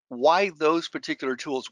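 normal talking voice

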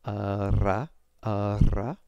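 "ra" with a trilled rolled R. Shown as a demonstration. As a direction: roll the R in "ra" loudly. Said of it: "that would sound like".

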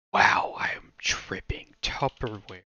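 slow breathy whisper to himself. Real, not acted. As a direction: murmur it slowly in disbelief. Confused by the word, to himself.

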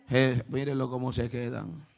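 soft low playful jab; teasing aside. Real, not acted. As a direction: tease the still congregation quietly.

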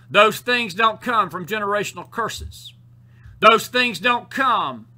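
building, insistent repeated denials; forceful preaching. Real, not acted. Preacher telling folks where sin doesn't come from, forceful preaching. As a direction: pound out each line, rising in force.

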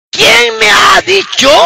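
high, slow shouted challenge; retold protest to God. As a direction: demand an answer from God. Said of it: "de esa manera que tú puedas reclamar". (reclamo a Dios a voz alzada)

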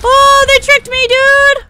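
loud, high-pitched indignant yell; shocked outcry. Real, not acted. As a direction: cry out at being tricked, very loud. Tricked by enemies in game.

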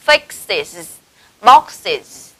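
"fixes, boxes" with -iz after the x; IS plural endings. Shown as a demonstration. Teacher modelling x-words for students, "fixes, boxes" ending in -iz. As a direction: say "fixes, boxes" separately, both syllables clear. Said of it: "chúng ta sẽ đọc thành".

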